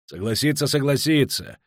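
scornfully snorted reply; snorting scorn. A narrator's reading. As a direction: brush off the begging impatiently. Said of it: "фыркнул Фиш".

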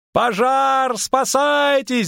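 high, drawn-out howl; panicked high wail; wailing alarm. A narrator's reading. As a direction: howl the alarm, stretching the words. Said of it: "завыл он".

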